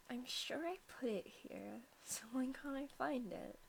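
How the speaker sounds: softly whispered, searching in the dark; whispering to herself. Acted. Girl searches her bag in the dark, whispering. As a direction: whisper it as if digging through a bag.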